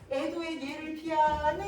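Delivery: slow singsong jeer, rising at the end; taunting. Shown as a demonstration. Teacher showing how So Unseong taunts Songgi, taunting singsong. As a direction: tease with a long stretched final syllable. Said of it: "어, 약 올리는 느낌이야".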